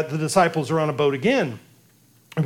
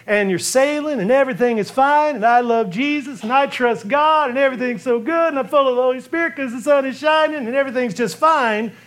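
higher, breezy mock-cheerful voice; mock carefree; 5 semitones higher than usual. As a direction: parody the smug sunny believer. Mocking carefree little faith.

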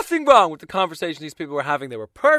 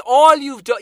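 normal talking voice

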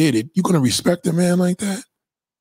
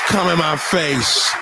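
slow mock moan; slower than usual. Acting woman aroused.

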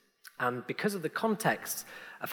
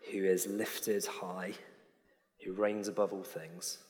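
quiet prayer; 5 semitones lower, 5 dB quieter than usual. Acted. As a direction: pray gently, close to a whisper. Leads the congregation in prayer.

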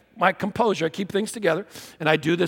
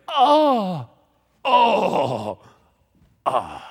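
acted dying groans; 5 semitones higher, 4 dB louder, much slower than usual. Dad imitating his son acting out a death, dying groans. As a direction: ham up a charade death. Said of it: "he could die really good".

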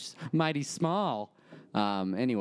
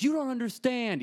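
doctor's outburst; 12 semitones higher than usual. Retold or imitated. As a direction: let the doctor lose patience, up high. Doctor slams his hand down.

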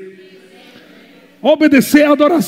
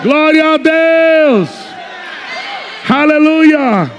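drawn-out fervent Aleluia cry; stretched shout; 5 semitones higher, much slower than usual. Real, not acted. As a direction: call it out to the whole church. Preacher calling out to congregation.

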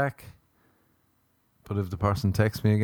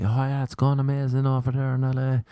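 mock upbeat lie; 4 semitones higher than usual. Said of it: "They lie to their family".

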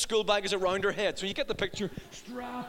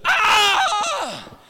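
high, loud panicked scream; action-required scream; 11 semitones higher, 9 dB louder than usual. Retold or imitated. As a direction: shriek like something is really wrong. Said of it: "And then there's the".

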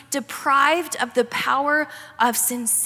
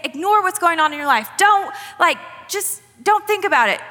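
higher and louder, brushing worries off; mock dismissive voice; 5 semitones higher, 4 dB louder than usual. Preacher mocks a wrong message, higher and louder. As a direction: toss it away like nothing matters. Mocking what God isn't saying.

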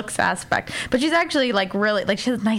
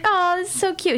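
high-pitched cooing over keepsakes; retold gushing; 8 semitones higher than usual. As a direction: gush sweetly, imitating a sentimental wife. Mocked gushing over old stuff.

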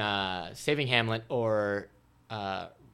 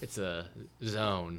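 deadpan; 5 semitones lower, 10 dB quieter, much slower than usual. Acted. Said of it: "I shouldn't have said it".

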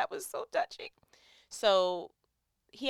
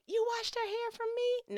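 delighted surprise; 14 semitones higher than usual. Retold or imitated. Delighted quote of own reaction.

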